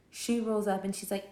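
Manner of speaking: ordinary delivery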